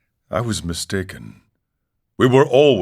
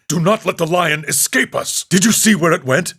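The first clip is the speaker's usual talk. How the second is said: high, urgent call to hurry; don't let it get away; pressing, higher and eager; urgent chase; 10 semitones higher, 4 dB louder than usual. Acted. Urgent chase after the lion.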